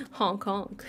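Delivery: soft giggly clown honk; clown imitation. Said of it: "That was my clown imitation".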